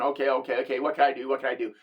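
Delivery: low-pitched, understated panicky thinking aloud; mock fretting to yourself, kept low; retold freak-out. Storyteller replaying own panicked thoughts, mildly freaked out. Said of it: "so I'm freaking out. I'm thinking".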